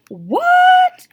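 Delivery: very high, loud, drawn-out impressed What. A narrator's reading. Father hearing his daughter threw tear gas, impressed. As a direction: sound secretly impressed. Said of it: "but in that impressed way".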